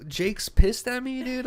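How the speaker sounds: high, slow disbelief; retold confusion. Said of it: "'cause I was confused".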